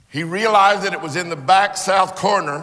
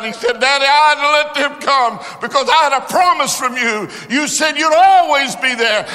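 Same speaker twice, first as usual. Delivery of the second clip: loud, high, driving sermon voice; preaching at full force; 9 semitones higher, 7 dB louder than usual. Acted. Preacher retelling the rescue to the congregation, full-force preaching.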